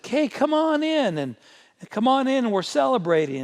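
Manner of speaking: father begging his angry son to join the party; coaxing father. Acted. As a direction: call him in warmly like a pleading dad. Father coaxes angry older son.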